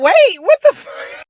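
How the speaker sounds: high, loud, slowed-down disbelief; slow, shrieking what-the-fuck; stunned. Retold or imitated. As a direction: squeal it out, caught off guard. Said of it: "I was like".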